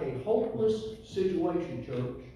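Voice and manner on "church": ordinary delivery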